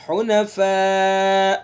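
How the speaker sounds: "حنفاء" with the final alif held long; slow model of "حنفاء" with connected madd. Shown as a demonstration. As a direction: stretch "حنفاء" slowly over the long vowel. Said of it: "مد متصل ہوگا اس کے اندر".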